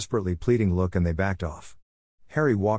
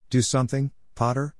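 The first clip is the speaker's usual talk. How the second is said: usual speaking voice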